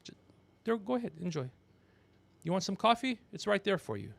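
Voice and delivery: ordinary talk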